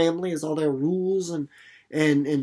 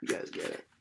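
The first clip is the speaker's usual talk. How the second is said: whisper; 11 dB quieter, faster than usual. Real, not acted.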